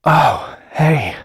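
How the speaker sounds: light up at seeing a friend walk in; happy surprise. Acted. Greets favourite customer arriving.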